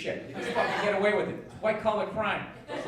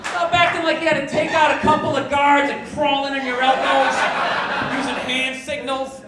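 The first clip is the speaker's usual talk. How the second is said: higher, louder ranting; mock outrage; 4 semitones higher, 6 dB louder than usual. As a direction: blow it up into a sarcastic tirade. Mock outrage at rescue owners.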